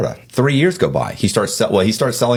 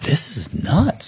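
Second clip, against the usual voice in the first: higher, drawn-out disbelief; retold bewilderment; 9 semitones higher, much slower than usual. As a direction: stretch it out slowly, pitched up, bewildered. Retold alarm at more police arriving.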